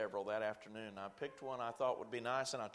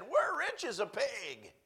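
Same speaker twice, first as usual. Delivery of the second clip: slow, high and pleased; delighted old lady; 9 semitones higher, 4 dB louder, slower than usual. Retold or imitated. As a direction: savour it like a tickled grandmother.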